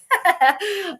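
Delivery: high burst of laughter; short, high-pitched chuckle. Real, not acted. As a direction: laugh at your own reluctant good wishes. Laughs after wishing rival luck.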